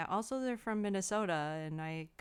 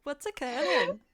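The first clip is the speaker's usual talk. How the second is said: louder Welsh catchphrase in a high voice; Welsh accent; 4 semitones higher, 6 dB louder than usual. Pretend. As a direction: greet them like a cheeky Welsh local. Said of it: "That was great".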